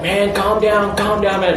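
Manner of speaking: high, insistent calm-down; imitated urgent calming. Mike trying to settle Sam outside, imitated urgent calming. Acting out friend confronting Sam.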